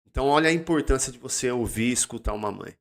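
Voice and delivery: ordinary talk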